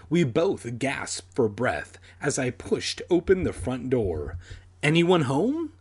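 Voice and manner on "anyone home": higher, raised call into the house; contained narrated shout. A narrator's reading. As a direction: call out to see who's in. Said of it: "I shouted into the house".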